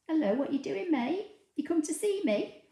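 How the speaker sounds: high, lilting greeting to a dog; talking to pet. Real, not acted. Host greets dog who came to see her, high pet-talk.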